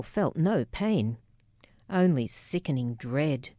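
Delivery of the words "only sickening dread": usual speaking voice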